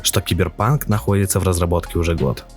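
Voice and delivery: normal talking voice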